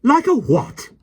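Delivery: high, shocked outraged question; can't believe her ears; shocked nanny. A narrator's reading. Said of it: "She looked quite petrified".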